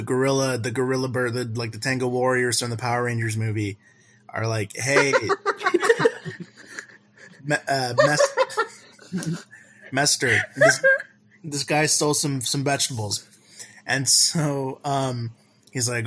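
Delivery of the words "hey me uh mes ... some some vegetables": laughing mock guard. Guard voiced, laughing through.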